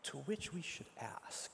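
hushed aside to the congregation; whisper. Real, not acted. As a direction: breathe it as a secret. Preacher drops to a whisper.

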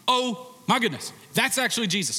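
high, amazed oh my goodness; sudden realization. Acted. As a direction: gasp it out as it dawns on you. Peter recognizing Jesus on shore.

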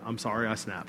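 low, soft, grudging apology; subdued and unwilling, barely offered; reluctant apology. Retold or imitated. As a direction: retell a reluctant sorry, dropped right down. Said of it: "I was reluctant".

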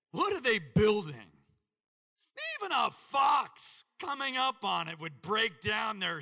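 loud, sneering mockery, slow at the end; mocking. Tobiah jeering at Nehemiah's builders, loud and mocking. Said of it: "He mocks them".

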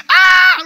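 very high, loud startled yelp; stunned high scream; shocked cry. Retold or imitated. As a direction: shriek in disbelief at her answer. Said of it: "I was like".

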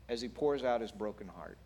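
normal talking voice

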